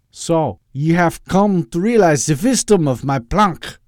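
put-on loud, slow, high Austrian-tinged villain accent; evil accent. Doctor Loeb playing villain to Edwin, evil accent. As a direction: gloat in a hammy evil accent. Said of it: "talked in the evil accent".

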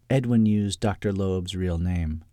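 plain reading voice

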